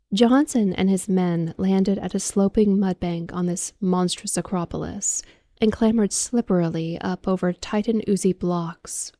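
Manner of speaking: ordinary delivery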